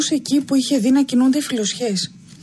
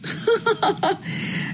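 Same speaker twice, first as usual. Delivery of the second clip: high, breathy giggling laugh; a mischievous child laughing from a hiding spot; child's laugh; 10 semitones higher, 5 dB quieter than usual. A narrator's reading. Boy in the tree laughs at his searching father. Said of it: "άκουσε το γέλιο".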